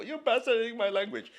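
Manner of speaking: a playful put-on protest from a native speaker; mock offended listener. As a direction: play an indignant Hebrew speaker, hammed up. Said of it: "some of you who are in Hebrew go".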